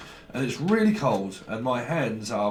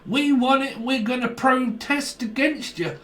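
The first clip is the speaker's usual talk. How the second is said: mocking whiny children; 10 semitones higher than usual. Mocking whining complainers.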